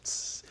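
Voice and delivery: sharp imitated hiss; snake strike noise. Pit vipers striking the man's arms, sharp hiss. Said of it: "they're just striking him".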